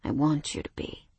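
low, husky sleepy murmur. Acted. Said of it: "he murmured huskily".

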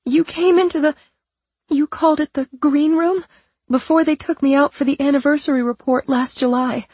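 high, anxious, pleading voice; fake scared voice. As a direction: sound small and frightened. Patricia keeps up frightened act.

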